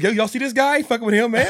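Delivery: high, loud imitation of a comedian; loud, high retold protest; comic calling out. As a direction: call the crowd over in mock alarm. Comedian calls out to audience.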